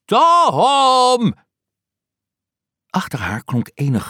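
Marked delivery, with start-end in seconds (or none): 0.0-1.3 very high, loud shout. Acted. Aunt shouting toward the garden for Tom, loud high shout. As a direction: throw the name far into the garden.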